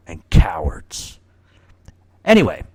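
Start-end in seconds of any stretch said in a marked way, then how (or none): 0.1-1.2 low, slow, breathed-out contempt; scornful whisper. Real, not acted. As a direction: spit out the last word under your breath. Contemptuous end of a rant.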